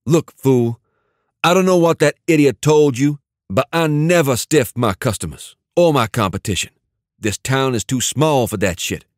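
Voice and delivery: a businessman thug setting the record straight; irritated gang boss. A narrator's reading. Dante insisting to the caller he never stiffs anyone, irritated.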